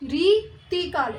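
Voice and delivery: रीतिकाल drawn out slowly, long री; teacher's slow model of रीतिकाल; slow careful pronunciation. Shown as a demonstration. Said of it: "इसे हम बोलेंगे रीतिकाल".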